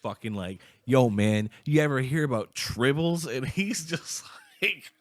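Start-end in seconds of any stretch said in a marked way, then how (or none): 0.8-3.3 loud, eager, higher nerd pitch; blurting at a classmate in science class, loud and eager; excited pitch. Retold or imitated. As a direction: hype him up about tribbles. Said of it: "I'm just, like, going crazy about it".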